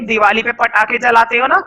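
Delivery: ordinary talk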